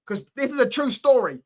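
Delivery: ordinary talk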